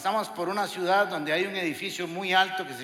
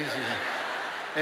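ordinary delivery

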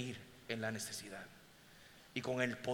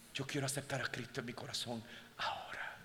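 whisper; 3 semitones lower, 8 dB quieter than usual. Retold or imitated. Woman quietly asks for Christ.

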